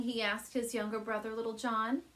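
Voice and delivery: ordinary delivery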